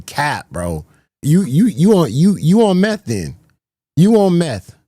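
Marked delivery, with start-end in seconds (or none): 1.2-4.8 louder and higher, teasing call-out; joking accusation. Real, not acted. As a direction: roast the viewer who claimed it. Accusing chatter of lying, joking.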